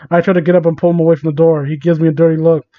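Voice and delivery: usual speaking voice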